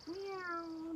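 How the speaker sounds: soft, high drawn-out meow; cat meow. Pretend. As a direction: mew like a kitten for kids. Acting a jungle cat.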